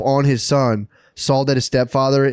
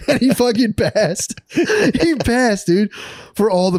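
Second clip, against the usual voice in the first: laughing through the words, pitched up; can barely get it out for laughing; giggling disbelief at the punchline; 9 semitones higher, slower than usual. Real, not acted. Laughing while telling the story.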